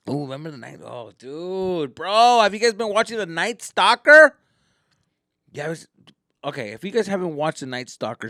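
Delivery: ordinary talk, nothing marked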